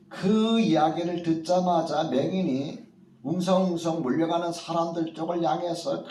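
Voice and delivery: ordinary talk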